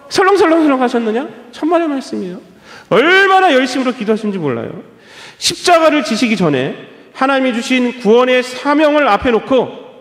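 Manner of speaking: usual speaking voice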